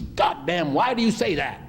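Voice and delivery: high-pitched mock exasperation; angry outburst, imitated. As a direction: act out his angry protest, voice shooting up. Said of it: "he really got angry".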